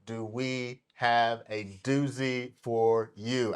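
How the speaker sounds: drawn-out, measured teaser; deliberately slow. Real, not acted. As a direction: spell it out slowly to build suspense. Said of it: "I have to say it slow".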